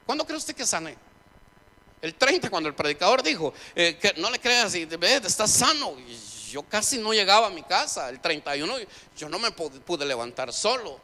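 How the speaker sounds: high and loud, pressing questions at the crowd; full-force preaching. Acted. Preacher challenging the congregation about the day of healing, loud.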